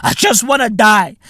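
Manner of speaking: usual speaking voice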